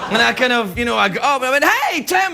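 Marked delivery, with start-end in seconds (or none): 1.6-2.3 high, bright call across the room; excited greeting. Retold or imitated. Calling out to Tim Robbins, overly excited. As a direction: call out to him eagerly.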